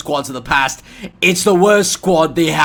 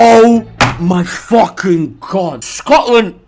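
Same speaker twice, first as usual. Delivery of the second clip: exasperated shout; 7 dB louder, much slower than usual. Real, not acted. Exclaiming at the draw.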